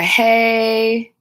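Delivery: normal talking voice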